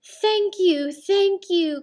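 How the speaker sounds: high, loud grateful cry; loud thankful creatures. A narrator's reading. Creatures thanking Penduli, calling out high and loud. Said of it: "called the creatures".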